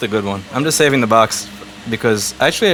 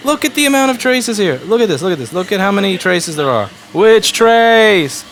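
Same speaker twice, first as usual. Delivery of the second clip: exasperated rant; 7 semitones higher than usual. Real, not acted. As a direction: vent at the nitpicking chat. Ranting at chat about trace.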